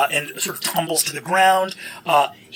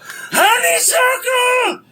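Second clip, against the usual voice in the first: high, drawn-out shout; creature calling out; 4 dB louder, much slower than usual. Acted. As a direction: holler the name slowly in a high creature voice. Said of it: "you hear a voice go".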